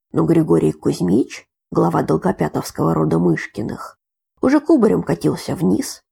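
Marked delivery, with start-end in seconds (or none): none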